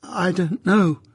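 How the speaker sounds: put-on small frightened boy; whimpered. A narrator's reading. Pip whimpers an answer to his sister. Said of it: "I whimpered".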